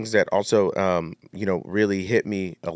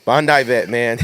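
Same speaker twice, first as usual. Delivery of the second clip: laughing; 4 semitones higher, 5 dB louder than usual. Real, not acted. Laughing shout-out.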